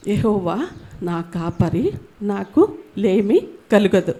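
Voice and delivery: slow, low, reverent reading of the verse; slow scripture recitation. Shown as a demonstration. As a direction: let each word of the psalm settle. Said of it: "మొదటి వచనము". (నెమ్మదిగా భక్తితో వచనం చదవడం)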